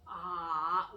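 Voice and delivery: bend "Ah" down then up, loud; twisted tone. Shown as a demonstration. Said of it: "I call it a twisted tone".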